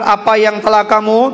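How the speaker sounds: ordinary talk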